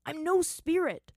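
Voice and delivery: loud, high, drawn-out denial; exasperated. Acted. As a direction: insist slowly, out of patience. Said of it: "losing his patience".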